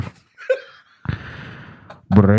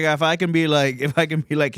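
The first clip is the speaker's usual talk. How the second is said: usual speaking voice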